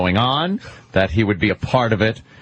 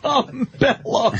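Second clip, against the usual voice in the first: drawn-out high mock voice; mocking name; 12 semitones higher, much slower than usual. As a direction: stretch the name out high and slow.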